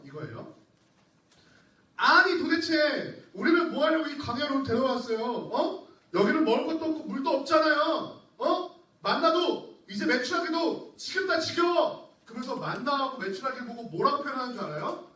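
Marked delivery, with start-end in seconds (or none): 2.0-12.1 higher, louder whining challenge; defiant complaining Israelites. Acted. Preacher voicing Israelites defying God in the desert, whiny challenge. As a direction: throw the complaint back at God. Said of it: "어떻게 덤비냐면요".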